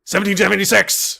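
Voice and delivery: high, loud rallying cry; patriotic shout. Acted. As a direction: yell it like a battle cry. Patriotic conspiracy rant.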